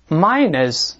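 "minus" repeated clearly, MY-nus, falling tone; model reading. Shown as a demonstration. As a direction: repeat "minus" slowly for students. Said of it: "再来一遍". (再示范一遍"minus"，语调下降)

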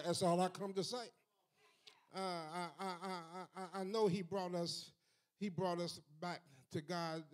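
normal talking voice

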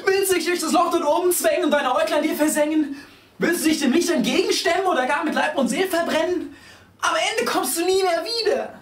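put-on high, loud tearful old woman; grandma crying up at the climbing child, high-pitched; weeping grandma. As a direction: plead through mock tears like a granny. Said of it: "sie fängt an zu flennen".

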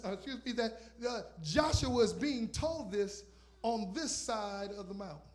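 loud fervent sermon, punched words; preaching at full force. Acted. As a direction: bring the heat.

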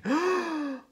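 high-pitched story gasp; horrified gasp. A narrator's reading. Blue Squirrel watches Hippo snapping cookie, horrified gasp. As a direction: gasp at what you see.

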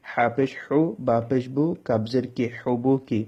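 ordinary delivery

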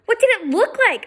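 high, sharp snippy retort; girl snaps at her friend, high and irritated; waspy. Acted. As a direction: snap it back, irritated. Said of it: "sounding waspy".

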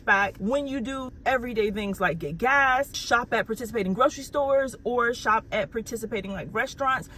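lively, emphatic sponsor pitch; upbeat ad read. Real, not acted. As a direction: sell it with real enthusiasm. Sponsor read with contest announcement.

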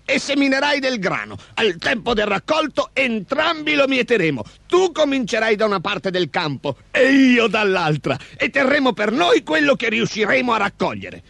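high-pitched ogre character voice; grudging scheming ogre. A narrator's reading. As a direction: set out new terms as a high-voiced creature. (voce acuta da orco)

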